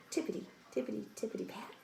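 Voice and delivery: soft, sneaky tippity steps; hushed bunny footsteps; hushed tiptoe. A narrator's reading. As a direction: tiptoe the words like sneaking bunnies.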